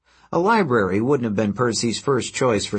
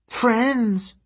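very high-pitched little creature voice; harpy echo; 12 semitones higher than usual. A narrator's reading. Harpy echoes Percy's word.

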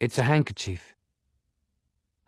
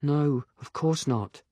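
put-on young woman voice; 5 semitones higher, slower than usual. Agatha denies, slow.

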